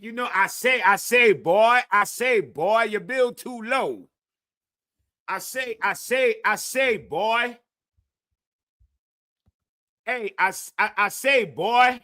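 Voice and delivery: a hint of a southern cartoon rooster drawl; Foghorn Leghorn. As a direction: loose Foghorn Leghorn impression. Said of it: "go watch some Fargo and Leghorn".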